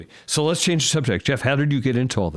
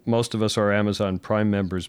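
usual speaking voice

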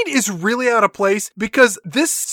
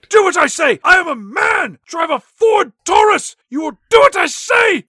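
high, loud hammed-up yelling boss; mock angry shout; 9 semitones higher, 5 dB louder than usual. [name] to the Spice Girls, hammed-up high shouting. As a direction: bellow orders like a cartoon tyrant. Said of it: "He says".

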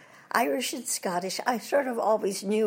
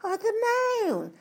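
high, rising English twit; silly toff voice, well above normal; Mister Twit; 13 semitones higher than usual. Retold or imitated. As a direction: ask it up high and timid. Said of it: "He says".

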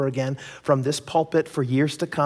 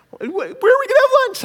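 much higher, louder mock wife voice; whiny wife; 6 dB louder than usual. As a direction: ham up the wife's complaint. Wife questioning the plan.

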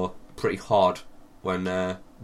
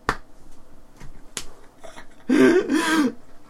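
wheezing laugh. Real, not acted.

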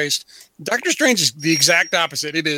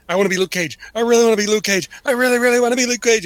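repeated plea, rising in pitch and quickening; building fanboy plea; 4 semitones higher, faster than usual. Retold or imitated. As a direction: imitate an eager fan, starting casual and climbing higher. Retold fan begging, escalating repeats.

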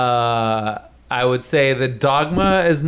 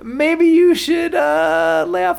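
laughing high; 13 semitones higher than usual. Real, not acted. Laughing mockery of Kevin Smith.

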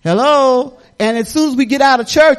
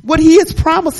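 usual speaking voice

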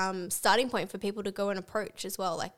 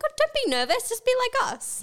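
bubbly; 6 dB louder than usual. Retold or imitated. Mimicking bubbly guests to nervous couple.

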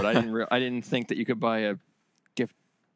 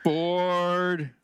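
loud, drawn-out heckle, pitched up; mock boredom; 5 semitones higher, 4 dB louder than usual. Friend heckling another's game pick, mock bored. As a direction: call it out long and high.

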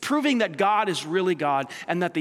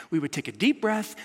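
ordinary talk, nothing marked

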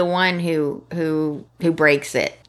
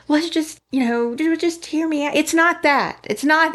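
high, pleading mock protest; put-on defensive man's voice; imitated backpedalling; 8 semitones higher than usual. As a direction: stammer excuses, pitched well up. Voicing his backpedalling protest.

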